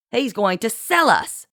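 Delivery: high, bitter accusation. A narrator's reading. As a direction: spit it out bitterly. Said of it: "Kit supplied bitterly".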